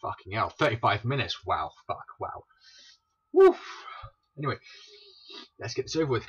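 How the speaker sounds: ordinary talk, nothing marked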